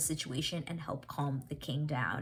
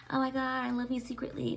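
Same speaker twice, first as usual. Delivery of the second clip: higher, gushing imitation of Colin; mock swooning confession; 8 semitones higher than usual. Colin imagined confessing love to Penelope, high gushing voice. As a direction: gush like a lovestruck fan fantasy. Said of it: "yay, yay, yay".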